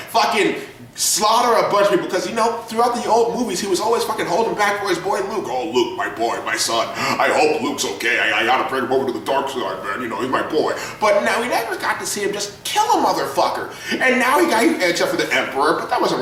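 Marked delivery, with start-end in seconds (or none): 5.5-10.7 lowered, tender mock Vader; mock doting Vader. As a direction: croon it like a soppy father worrying about his son. Vader voiced as doting father.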